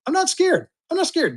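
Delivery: a bit of playful false bravery; mock bravado. To chat about a big bid, mock brave.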